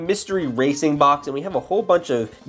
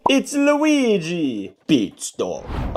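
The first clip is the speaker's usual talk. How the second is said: higher, very slow announcement; drawn-out reveal; 5 semitones higher, much slower than usual. Acted. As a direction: stretch out the name for the big reveal. Drawn-out reveal of next car.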